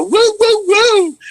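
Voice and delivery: put-on high, excited old lady calling woo; old neighbor's call. Eighty-five-year-old neighbor cheering a booked job, high call. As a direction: holler it like Rhoda greeting you in the hall. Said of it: "So I call this the Rhoda".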